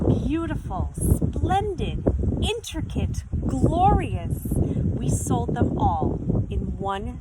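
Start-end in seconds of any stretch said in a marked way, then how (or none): 0.1-4.5 higher and louder, gushing praise; admiring exclaims. A narrator's reading. Customers in the shop admiring the eggs, gushing loud. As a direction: gush over each word.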